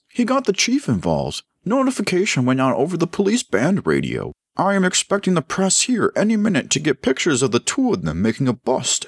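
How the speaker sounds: high-pitched, worked-up officer voice; agitated police officer. A narrator's reading. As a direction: report the bad news in a high voice.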